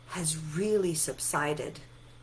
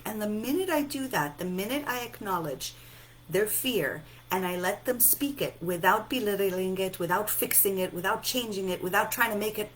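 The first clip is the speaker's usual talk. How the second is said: ordinary talk, nothing marked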